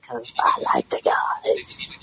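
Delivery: hushed confession; whispering. Real, not acted. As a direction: whisper it like a guilty secret. Confessing liking the guys.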